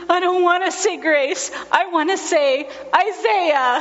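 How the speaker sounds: put-on high little-boy voice, earnest after tears; a tearful toddler explaining himself; high child voice, sincere and sniffly; sniffling child. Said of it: "he sniffled a few times".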